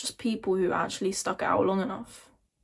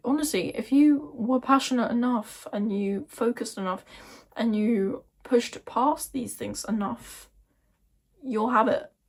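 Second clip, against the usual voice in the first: ordinary talk, nothing marked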